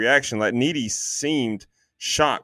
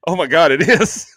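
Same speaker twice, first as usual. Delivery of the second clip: louder, higher imitated astonishment; retold amazed realization; 4 semitones higher, 6 dB louder than usual. Girlfriend hears the actor talking, mock amazed. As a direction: act out the sudden recognition.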